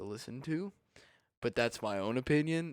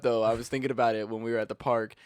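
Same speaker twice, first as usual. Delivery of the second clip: ordinary delivery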